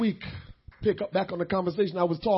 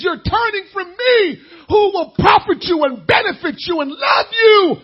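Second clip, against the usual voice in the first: preaching shout; 10 semitones higher, 12 dB louder than usual. Acted. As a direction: cry it out to the church. God's words preached at full force.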